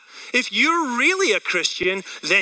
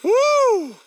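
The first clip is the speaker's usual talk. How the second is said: very high, loud joking whoop; mock whoop; 4 dB louder than usual. Pastor cheering his mock celebrity self, loud high whoop. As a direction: whoop like a crowd cheering you.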